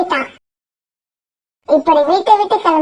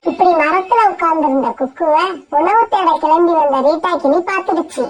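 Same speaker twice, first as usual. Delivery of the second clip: ordinary delivery, nothing marked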